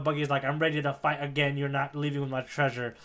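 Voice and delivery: ordinary delivery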